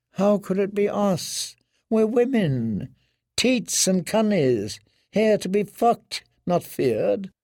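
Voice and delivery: high, slow, sneering woman's voice; toying with him, falsely meek; mocking washerwoman. Holly mocks Theon.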